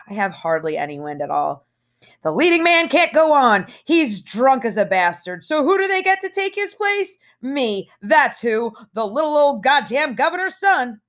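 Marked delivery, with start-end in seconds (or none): 2.2-11.0 high, showy announcer flourish; movie musical star. Pretend. Holden playing a musical star while tap dancing, showy high voice. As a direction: announce it like a Broadway opening. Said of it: "imitating one of those guys in the movies".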